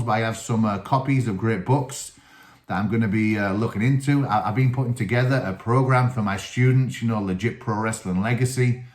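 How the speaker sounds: ordinary talk, nothing marked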